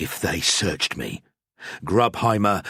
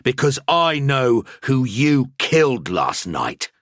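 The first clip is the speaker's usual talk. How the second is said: each word slow, weighty and louder; slow and emphatic; 6 semitones higher, 4 dB louder, slower than usual. A narrator's reading. Priest turning the accusation back on Grubheimer, slow and emphatic. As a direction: lay every word down deliberately. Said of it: "I said slowly and with emphasis".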